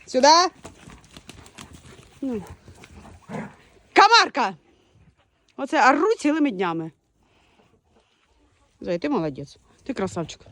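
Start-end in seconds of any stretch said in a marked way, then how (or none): 0.1-4.6 calling out. Real, not acted. Calling the dog. 8.8-10.4 softer, lower coo to the dog; soft doting voice; tender praise. Real, not acted.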